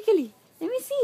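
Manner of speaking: playful high voice. Real, not acted. Mother coaxing toddler, high voice.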